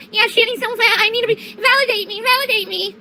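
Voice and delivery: high, squeaky mock bully. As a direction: sneer as a needy bully. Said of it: "mocking bully continued".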